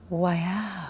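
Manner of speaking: very soft, low "wow" imitating dad; dad's stunned reaction. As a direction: imitate a speechless father. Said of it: "He goes".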